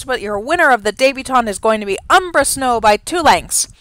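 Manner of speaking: fast, loud race call building to the finish; race caller. Acted. Announcer calling the race to viewers, loud and building. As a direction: call the horses home down the stretch.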